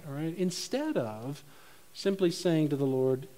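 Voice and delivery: usual speaking voice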